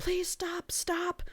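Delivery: high, strained plea to make it stop; high pained begging for it to stop; pained pleading. Retold or imitated. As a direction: beg the noise in your head to quit. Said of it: "she's like".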